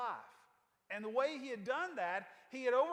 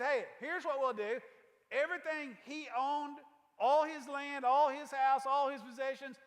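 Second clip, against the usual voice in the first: emphatic, loud; 3 semitones higher, 6 dB louder than usual. Retold or imitated. King giving Haman's estate.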